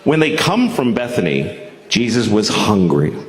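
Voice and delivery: solemn Bible-reading voice, measured; scripture reading. Acted. Comic reading scripture to the crowd, solemn Bible voice. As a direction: read it like a preacher at the pulpit. Reads scripture in King James style.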